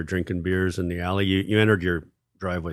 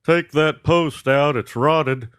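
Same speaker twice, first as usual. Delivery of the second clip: slow, higher, louder know-it-all neighbor drawl; neighbor impression; 6 semitones higher, 5 dB louder, slower than usual. Neighbor correcting a musician's fence repair, slow loud imitation. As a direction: draw it out loudly as the meddling neighbor. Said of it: "he comes over and he goes".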